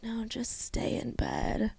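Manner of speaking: slow, hushed whisper luring someone to stay; whispery, drawn-out cartoon cat voice; depression kitty. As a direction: coax them softly back to bed. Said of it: "there's the depression kitty".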